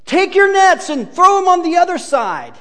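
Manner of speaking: high, loud shout from the beach; calling instructions. Acted. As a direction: call the instruction out across the water. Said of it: "the guy on the beach says".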